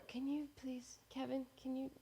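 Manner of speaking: soft plea, not to wake the mic; hushed plea. Real, not acted. Pleading with the meowing cat Kevin, hushed. Shushing the cat mid-recording.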